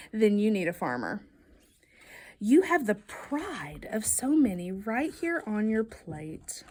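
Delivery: plain reading voice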